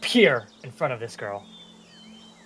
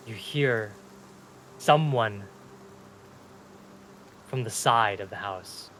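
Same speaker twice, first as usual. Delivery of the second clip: ordinary delivery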